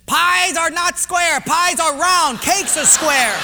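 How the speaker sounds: slow, high, loud pidgin answer; Portuguese guy, sure of himself. Acted. Portuguese guy corrects the quizmaster, loud and emphatic. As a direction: declare it emphatically, one slow phrase at a time.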